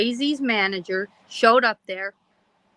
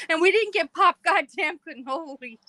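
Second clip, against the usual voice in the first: higher-pitched exasperated gripe; mad; 7 semitones higher than usual. Retold or imitated. Mother griping to her son about missed cannolis, high mock-angry complaint. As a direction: whine it up high like you've been cheated. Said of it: "I was so mad".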